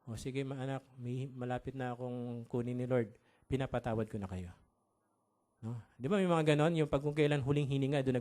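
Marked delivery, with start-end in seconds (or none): 0.0-4.5 dying mother. Acted. Dying mommy forgiving her children before the Lord takes her, low and weak. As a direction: speak low and quiet, as from a deathbed. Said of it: "Tapos sasabihin ni mommy".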